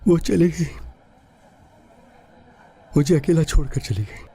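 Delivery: high, grief-stricken lament; emotional, grieving. Acted. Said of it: "मैं थोड़ा emotional हो गया था".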